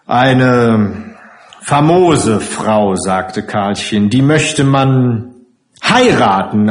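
slow, drawn-out relish; ironic admiration. Acted. Karlchen mock-praises the dreadful matron, slow and emphatic. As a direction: savour the praise word by word.

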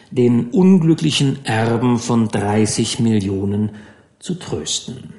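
usual speaking voice

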